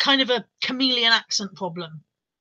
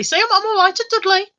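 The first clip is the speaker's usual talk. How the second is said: Dudley accent; 9 semitones higher than usual. Shown as a demonstration. Answering a viewer's praise, Dudley accent. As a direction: boast in a mock Dudley accent. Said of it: "That was so Dudley".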